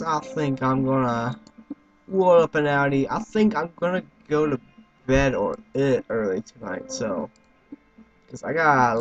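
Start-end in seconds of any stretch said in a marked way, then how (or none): none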